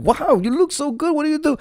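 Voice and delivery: high-pitched admiring amazement; imitating awestruck brides; gushing brides. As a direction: coo in wonder, way up high. Brides gush over looks.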